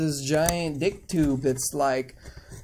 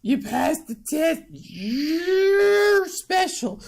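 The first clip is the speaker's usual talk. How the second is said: put-on high-pitched, drawn-out old lady voice; mock old lady gushing, stretched and high; 13 semitones higher, slower than usual. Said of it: "then the old lady is like".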